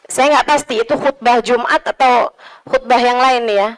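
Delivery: ordinary talk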